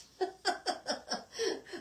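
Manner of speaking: a sudden airy laugh at herself; high unvoiced giggle; breathy embarrassed laugh. Real, not acted. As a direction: laugh off the slip. Embarrassed about French word.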